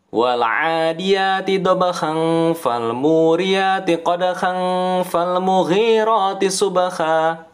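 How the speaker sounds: verses run together, tanwin kept; connected recitation. Shown as a demonstration. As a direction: recite continuously without stopping. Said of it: "Kalau bacanya cepat disambung".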